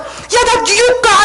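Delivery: high, drawn-out excited exclamation, re-enacted; astonished. Telling the friend she's going to New York, astonished. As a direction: break the news in amazement. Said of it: "제가 너무 놀래가지고".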